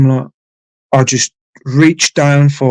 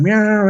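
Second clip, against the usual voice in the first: one high, thin imitated meow; imitating a meow; 9 semitones higher than usual. Cat looking up at him, imitated high meow. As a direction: squeak out the cat's plaintive meow. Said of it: "looking at me going".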